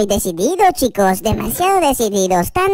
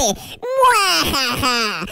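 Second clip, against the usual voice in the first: mustache twirling villain; much slower than usual. Acted. Villain plots to eat everyone.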